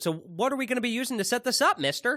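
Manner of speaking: put-on high, eager boy asking; wholesome 1950s kid. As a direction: ask it like a gee-whiz kid. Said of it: "I basically said".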